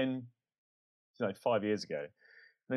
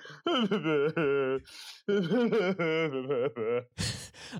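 comic crying fit, pitched up; mock sobbing; 3 semitones higher than usual. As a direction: imitate helpless sobbing, hammed up for laughs. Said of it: "I've never cried as much".

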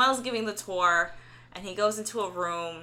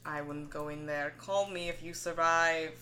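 ominous warning; 4 semitones lower, 6 dB quieter than usual. Retold or imitated. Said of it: "the way he says it is just full of foreboding".

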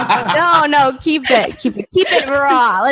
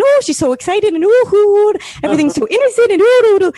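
high, excited singsong caricature, louder and gushing; Katie; 9 semitones higher, 4 dB louder than usual. Retold or imitated. Comic mimicking a friend from an old show, excited gushing. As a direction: coo it high and wide-eyed, hammed up. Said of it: "doing my impression of Katie".

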